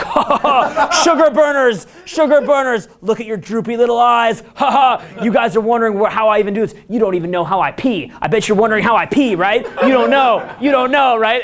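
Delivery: high, laughing taunt of exhausted crowd; mocking Tony Robbins. Tony Robbins mocking his tired audience, high cackling taunt. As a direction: gloat down at them like a cackling guru. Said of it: "He's, like, mocking you".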